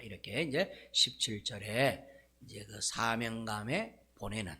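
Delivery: ordinary talk, nothing marked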